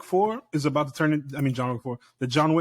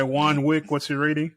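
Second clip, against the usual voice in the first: usual speaking voice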